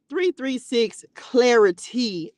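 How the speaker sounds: proud announcement. Shown as a demonstration. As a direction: announce it with pride, slowly. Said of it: "proud new name announcement".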